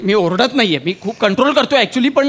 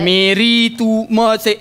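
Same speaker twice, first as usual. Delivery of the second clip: very slow, low mimicry of a stilted reading; mock the line by dragging each word; imitated broken reading; 5 semitones lower, much slower than usual. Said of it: "हे नका करू".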